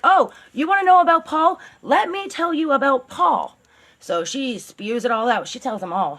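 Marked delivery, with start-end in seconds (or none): 0.1-3.6 high, louder mock-indignant outburst; vengeful spill. Carla to police after the beating, indignant mocking voice. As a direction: spit it out like you're finally getting even. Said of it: "she's like".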